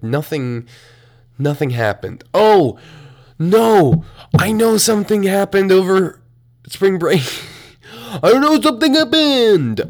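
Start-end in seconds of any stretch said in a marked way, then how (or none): 2.3-9.9 loud, high teasing voice; mock scandalized singsong. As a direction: tease like a gossip who knows. Teasing herself about spring break.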